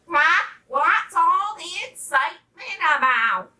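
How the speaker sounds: loud, high, demanding squawk; bossy duck calling out. A narrator's reading. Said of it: "demanded Missus Duck".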